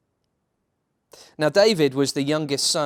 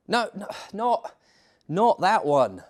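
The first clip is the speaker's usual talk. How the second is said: exasperated protest; 7 semitones higher than usual. Real, not acted. Narrator correcting the editor, exasperated. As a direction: cut off the mistake sharply.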